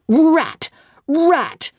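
put-on high, loud shout of a boy; boy bellowing in a scuffle. A narrator's reading. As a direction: bellow the insult at her. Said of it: "and bellowed out, out loud".

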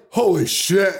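high, laughing mock astonishment; shocked moviegoer. Mimicking man's reaction to little stab, high mock shock. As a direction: imitate a gobsmacked first-time moviegoer. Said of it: "as if he has never seen a movie before".

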